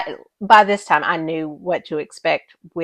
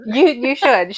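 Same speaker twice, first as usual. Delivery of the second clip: ordinary talk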